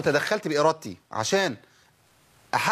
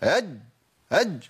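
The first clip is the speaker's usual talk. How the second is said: "أج" jabbed hard, with excess pressure on the jeem; forced jeem. Shown as a demonstration. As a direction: slam shut on the jeem in "أج". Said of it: "خلي الامور سهله كده".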